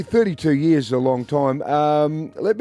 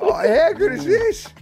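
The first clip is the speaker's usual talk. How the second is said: thrilled. Real, not acted. Thrilled by waterbed family.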